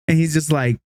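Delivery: ordinary talk, nothing marked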